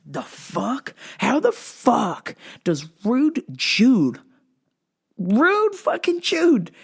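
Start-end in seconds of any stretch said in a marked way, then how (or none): 1.2-6.7 high, slow, disbelieving squeal; incredulous. Real, not acted. Podcaster marvelling that the rapper knows him, squealing in disbelief. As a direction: stretch it out in sheer disbelief.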